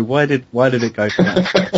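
talking through laughter; laughing. Real, not acted. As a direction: laugh while asking. Still laughing after the joke.

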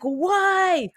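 baffled. Retold or imitated. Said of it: "And you're like".

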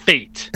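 fate held back after a pause, then hit; mock emphasis on fate. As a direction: parody the teacher's weighty fate. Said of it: "hitting that word fate so hard".

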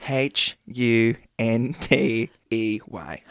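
slow letter-by-letter wrong spelling of hunty. Shown as a demonstration. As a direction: spell it out slowly, one letter at a time. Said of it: "Can you please spell that for me".

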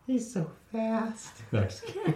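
put-on high little girl voice. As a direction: tease like a small girl. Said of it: "wanted me to be the little girl".

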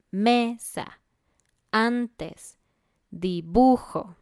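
"mesa, antes, dibujo" slow, long strong next-to-last syllable; penultimate stress. Shown as a demonstration. Teacher giving llana examples, penultimate stress in "mesa, antes, dibujo". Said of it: "más fuerte en la penúltima sílaba".

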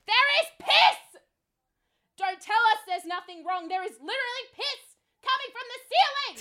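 high, loud shout of disbelief; outraged shout. Retold or imitated. Fed-up guest telling management about the leak, outraged shout. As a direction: yell it at full outrage, voice shooting up.

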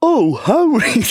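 high, slow doofy voice; goofy, dopey greeting; Ron impression. Ron greeting Harry in a high dopey voice. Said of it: "He say".

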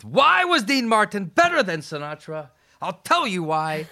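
high, loud retold argument; animated mock-debate voice; animated debating. Acting out a spirited old debate.

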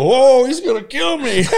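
high, loud laughing "whoa"; comic laughing at a death threat, mock alarm. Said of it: "I go".

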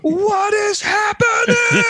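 high, slow shocked outcry; shocked shout. Real, not acted. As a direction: yell out in disbelief. Shocked reaction to the video.

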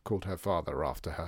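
ordinary delivery

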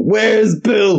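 high, drawn-out movie demand; hammed-up Kill Bill demand; mock interrogation. Quoting Kill Bill scene.

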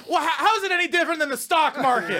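very high, loud whiny protest; hammed-up indignant squeal of a grown son; arguing son. Son defending gambling to mom minutes later, high mock indignation. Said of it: "Five minutes later".

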